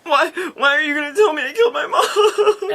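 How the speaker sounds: high, wailing fake sob; hammed-up tearful wail; mock crying. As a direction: imitate a kid bawling, pitch way up. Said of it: "He's crying".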